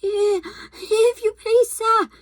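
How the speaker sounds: put-on scared boy trembling before the wolf. A narrator's reading. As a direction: plead timidly, barely getting the words out. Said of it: "trembling so that he could hardly speak".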